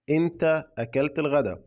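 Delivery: ordinary delivery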